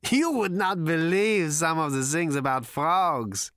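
high, slow, giddy character voice; gasped hilarity. A narrator's reading. Prack tells his visitors about frogs, gasping with amusement. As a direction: marvel helplessly, drawing it out high. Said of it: "he gasped".

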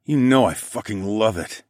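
lightly growled reassurance; a touch of growl in the answer; mild growl. A narrator's reading. Narrator answering his lover, mildly growling. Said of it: "I growled".

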